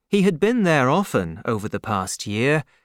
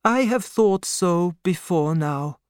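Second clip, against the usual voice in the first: put-on old doctor's voice; 5 semitones higher than usual. A narrator's reading. Doctor speaking.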